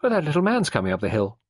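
quick, high and casual; offhand, light and hurried; light, everyday voice. A narrator's reading. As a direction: switch briskly to small talk. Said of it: "a light, everyday voice".

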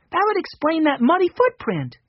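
put-on high, light little boy voice; young Benny. A narrator's reading. Narrator voicing young Benny.

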